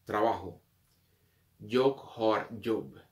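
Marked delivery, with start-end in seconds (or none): 1.6-3.0 "Jag har jobb" spoken very slowly; slow model sentence. Shown as a demonstration. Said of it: "slow model sentence". (frase lenta "Jag har jobb")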